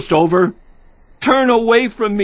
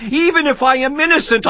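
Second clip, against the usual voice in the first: anguished outburst; 9 semitones higher, faster than usual. Acted. Anguished protest to God.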